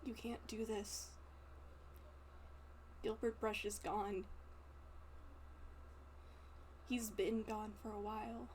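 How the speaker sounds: soft, quiet plea sinking to a whisper; experienced, gentle voice. Acted. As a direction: talk him down gently, fading to a whisper. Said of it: "with a voice that has experience".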